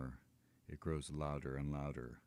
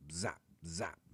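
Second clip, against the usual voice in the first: ordinary delivery, nothing marked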